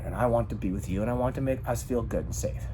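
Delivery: very soft, low reassurance; calm, loving. Shown as a demonstration. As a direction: make a partner feel safe with a hushed voice. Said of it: "I spoke like this".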